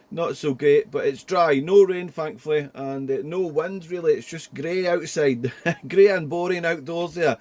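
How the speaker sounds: ordinary delivery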